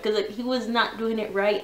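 normal talking voice

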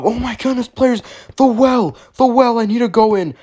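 high-pitched eager cries; hammed-up squealing excitement; excited urgency. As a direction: exclaim at a lifesaving find. Rushes toward the well.

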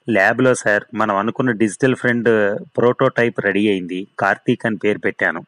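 ordinary delivery

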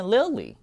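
Lily as li-uh-lee, pitched high; Lily gliding into "uh"; correct pronunciation. Shown as a demonstration. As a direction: say Lily with a schwa before the second l. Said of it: "它念Lily".

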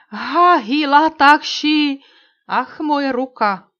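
high, loud, slow cry of pain; wounded cry. A narrator's reading. Wounded Indian dropping his torch, crying out in pain. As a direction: cry out as the bullet hits your hand. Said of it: "vykřikl zasažený indián".

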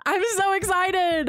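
high, drawn-out gush of excitement; excited squeal. Real, not acted. Streamer about to begin the game, excited high squeal.

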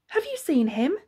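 high, loud, eager question; cried out. A narrator's reading. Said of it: "cried Ma".

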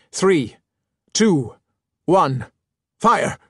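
loud, high countdown building to a yelled fire; shouted countdown. Acted. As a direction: bark the count and explode on fire. Captain counts down cannon fire.

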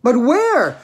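high, loud alarmed question; shrieked. Acted. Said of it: "shrieked Missus Salt".

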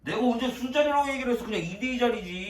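high-pitched heated denial; indignant protest. Real, not acted. Friend denying he ever said drinks, indignant.

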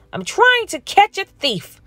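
hurried puppy; eager puppy voice. A narrator's reading. Max rushing past the pig, high puppy voice. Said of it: "Max replied".

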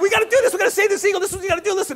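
a zookeeper pitching to the Sony president, excited and high; animated pitch. Retold or imitated. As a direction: sell it to the Sony boss with full energy. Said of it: "I'm kind of an animated guy".

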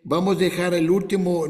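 ordinary delivery

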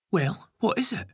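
put-on high-pitched, impatient child. A narrator's reading. Ben pestering Gary for the news, high and impatient. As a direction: demand an answer in a high voice.

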